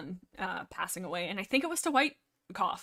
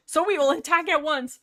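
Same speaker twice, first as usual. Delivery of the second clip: loud, higher-pitched battle order; bold declaration; 6 semitones higher, 8 dB louder than usual. A narrator's reading. Leader calls the clan to attack, loud declaration. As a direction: proclaim it like a leader.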